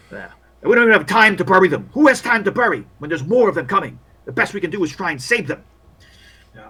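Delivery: overwhelmed war medic, pitch climbing; exasperated army doctor. Acted. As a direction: vent about the hopeless field hospital.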